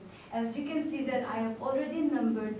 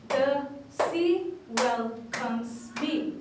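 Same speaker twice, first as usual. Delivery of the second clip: the sea welcomes me very slow, louder, one beat per syllable; syllables clapped out; 4 dB louder, much slower than usual. Shown as a demonstration. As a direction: tap each syllable like a clap. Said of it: "emphasizing on the number of syllables by clapping".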